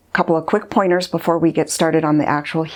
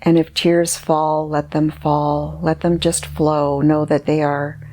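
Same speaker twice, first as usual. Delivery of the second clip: guided meditation. Acted. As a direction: guide the listener gently inward, leaving space between phrases.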